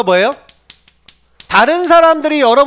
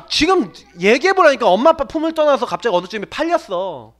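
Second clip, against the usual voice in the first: usual speaking voice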